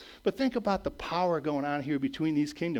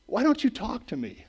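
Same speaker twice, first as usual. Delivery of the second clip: Pilate pleading; 9 semitones higher, 3 dB louder than usual. Retold or imitated. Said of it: "He says".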